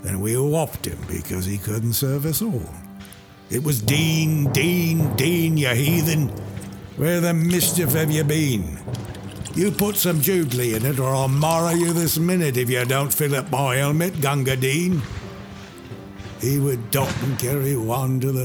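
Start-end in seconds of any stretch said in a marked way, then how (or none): 3.8-15.0 higher, louder impatient calling; soldiers yelling. Acted. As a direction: bark for the water boy. Soldiers yelling for water carrier.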